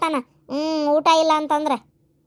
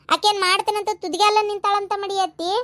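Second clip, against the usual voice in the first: normal talking voice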